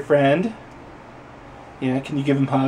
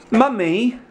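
put-on high, drawn-out child voice; high put-on kiddie pleading; pretend little child; 10 semitones higher, slower than usual. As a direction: play a kid sweetly pestering Mummy. Playing a child begging for cookies.

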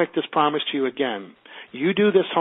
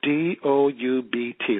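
slow spelling out; 2 semitones lower, much slower than usual. Shown as a demonstration. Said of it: "That's my Canadian accent".

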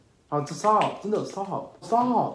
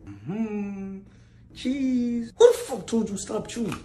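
normal talking voice

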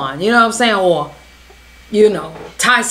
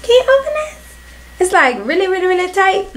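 put-on high, pleading little-girl voice; little kid voice; 8 semitones higher than usual. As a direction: beg like a small child.

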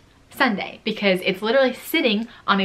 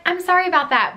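polite apology; 9 semitones higher, faster than usual. Retold or imitated. Apology to the customer.

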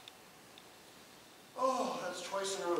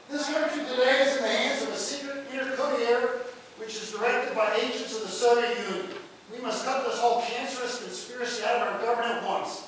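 reading a fiery denunciation; 5 semitones higher, 8 dB louder than usual. Acted. Lecturer quoting a senator's attack on Truman, mildly hammed up. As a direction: read it like a senator's tirade.

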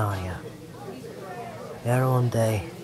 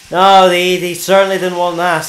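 loud, higher furious retort; angry retort; 4 semitones higher, 7 dB louder than usual. Acted. Interrogator threatening the witness, loud and angry. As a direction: spit it back at him in anger.

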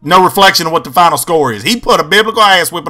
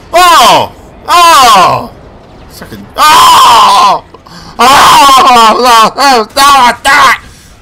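loud, higher oh's over and over; excited shouts; 6 semitones higher, 5 dB louder, much slower than usual. Real, not acted. Gamer yelling during a firefight, loud repeated oh's. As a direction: yell each oh as the fight goes on.

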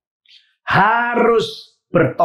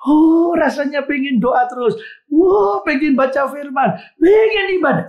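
fervent; 8 semitones higher than usual. Acted. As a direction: burst out with the eager joy of first love. Preacher describing first love fervour.

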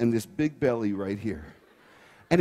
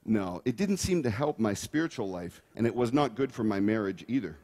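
ordinary talk, nothing marked

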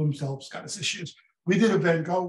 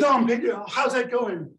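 lively quote; 6 semitones higher than usual. Retold or imitated. Voicing chat exchange.

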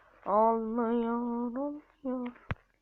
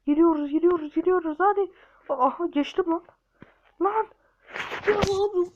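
higher, louder hopeful chant; excited cheer; 6 semitones higher, 6 dB louder than usual. Real, not acted. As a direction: build it up as you make it. Excitement passing the level.

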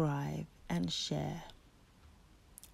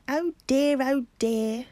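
high, loud chuckling squirrel; 7 semitones higher, 10 dB louder than usual. Acted. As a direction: chuckle it, amused. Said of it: "chuckled the squirrels".

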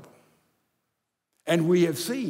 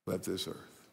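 hushed grief; 9 semitones lower, 10 dB quieter than usual. Real, not acted. Grief over late wife.

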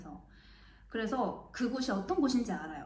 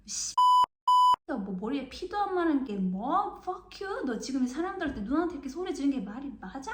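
higher, louder angry tirade; furious swearing; 5 semitones higher, 6 dB louder than usual. Retold or imitated. Narrator scolds the boy in furious Korean swearing. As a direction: tear into the boy like an angry big sister. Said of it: "한국말로 엄청 욕을 했어요".

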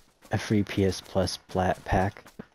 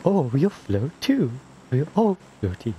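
creepy clown voice; 5 semitones higher than usual. Pretend. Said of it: "You sound like a pedophile".

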